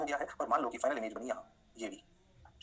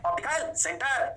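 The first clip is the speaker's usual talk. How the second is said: drawn out, each word stretched and falling; teacher repeating the key term slowly; slow emphatic repeat; 8 semitones higher, much slower than usual. Shown as a demonstration. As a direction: spell it out slowly for the class. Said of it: "अरे क्या कहते हैं?".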